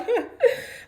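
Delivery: short high-pitched laugh; brief mocking imitation of his laughter; imitated laugh. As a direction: mimic him still laughing. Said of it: "And he's still laughing".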